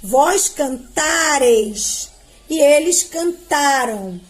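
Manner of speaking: slow, each form set apart; slow conjugation drill. Shown as a demonstration. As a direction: recite slowly for the class. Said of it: "Ó o cante aqui". (conjugação lenta, forma por forma)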